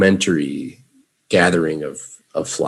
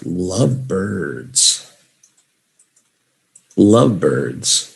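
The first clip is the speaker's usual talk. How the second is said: slow model word; much slower than usual. Shown as a demonstration. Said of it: "Say it with me".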